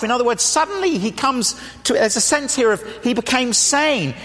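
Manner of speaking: ordinary talk, nothing marked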